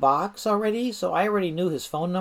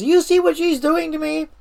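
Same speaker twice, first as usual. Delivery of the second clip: high, loud, outraged protest; yelled complaint; 10 semitones higher, 6 dB louder than usual. Retold or imitated. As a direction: yell it to the boss, pitch jumping up. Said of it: "he yells".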